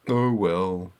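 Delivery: a low weary groan; low, weary groan of resignation. A narrator's reading. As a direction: sigh it out like a resigned old man. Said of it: "groaned Grandpa".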